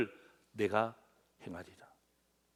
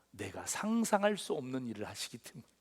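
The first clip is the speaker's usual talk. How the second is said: quiet; 6 semitones lower, 10 dB quieter than usual. Shown as a demonstration. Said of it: "예?".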